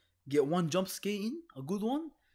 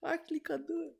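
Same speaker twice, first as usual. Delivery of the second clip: very high, strained whimper; panicked squeal; faster than usual. Real, not acted. Player too scared to go on, high squealing panic. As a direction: squeak it out, losing nerve. Terrified player wants to give up.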